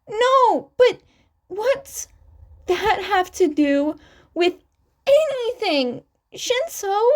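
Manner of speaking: high, indignant voice; Izuku's voice jumps up, offended and defensive; upset and cutting in. A narrator's reading. Izuku interrupts Shinso, high and indignant.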